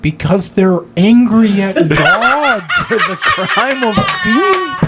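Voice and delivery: high, slow, breaking into a cry; Jordan Peterson giraffe. Acted. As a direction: plead it, voice cracking at the end. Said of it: "Jordan Peterson as a giraffe".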